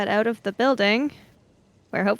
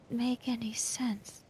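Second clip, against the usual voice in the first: whispered confusion; 10 dB quieter than usual. Acted. Confused character reacts to accusation.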